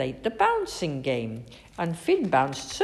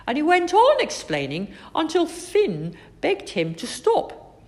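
ordinary delivery, nothing marked

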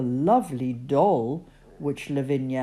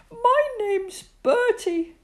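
Bertie Bear voice; 4 dB louder than usual. A narrator's reading.

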